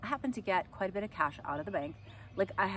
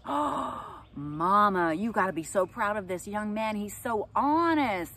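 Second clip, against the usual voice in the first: gushing fair lady; 3 semitones higher, 7 dB louder, slower than usual. Retold or imitated. Fair woman praising son to his mom, gushing. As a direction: pour on the praise like a sweet stranger.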